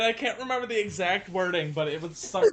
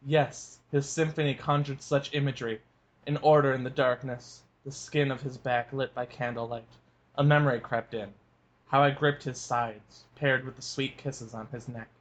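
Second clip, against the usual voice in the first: lower, measured book reading; story narration; 7 semitones lower than usual. A narrator's reading. Cohost reads the novel passage to listeners, calm narration. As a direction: read the scene calmly as a narrator.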